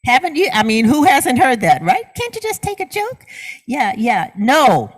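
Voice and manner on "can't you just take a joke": high mocking sneer of a teasing kid; mocking taunt. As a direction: mimic the bully's taunt, way up high.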